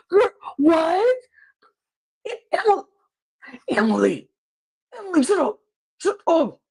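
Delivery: high, strangled mock screaming at no extra volume; mock inner scream. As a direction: imitate screaming inside your head, exasperated. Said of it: "he was screaming".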